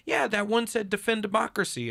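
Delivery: high, bright mock-wife voice; imitating wife. Husband imitating wife reading a sign, high chirpy voice. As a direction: play the wife, chirpy and sure.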